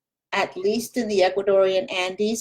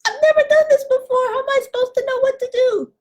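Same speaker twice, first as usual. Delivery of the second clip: much higher, fast, panicky little voice; whiny tongue voice; 3 dB louder, much faster than usual. Acted. The learner's tongue protesting in a high panicky voice. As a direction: squeak it out like a scared tongue. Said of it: "your tongue is saying".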